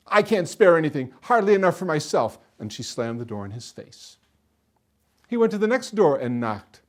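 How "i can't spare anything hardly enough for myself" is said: loud, insistent brush-off; irritated refusal. A narrator's reading. Woman refusing a hungry soldier food, irritated. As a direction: turn the beggar down firmly.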